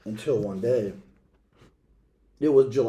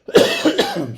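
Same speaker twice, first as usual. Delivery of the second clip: loud cough cutting into the story; real cough; 7 semitones higher, 8 dB louder than usual. Real, not acted. Reader apologizing to listeners after a loud cough. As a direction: cough hard, then carry on. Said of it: "Sorry, guys".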